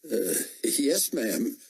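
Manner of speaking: nervous soldier. A narrator's reading.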